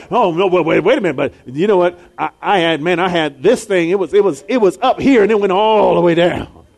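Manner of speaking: loud, eager one-upping of a sad story; mock eager congregant topping the last tale; one-upping storyteller. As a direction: push in, desperate to top their story. Preacher acts congregant one-upping.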